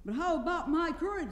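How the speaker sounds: high-pitched worried question; anxious. A narrator's reading. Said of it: "said the lion anxiously".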